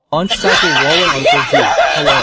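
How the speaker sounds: loud, laughing taunt; loud laughing jeer; mocking shout. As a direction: yell it out mockingly.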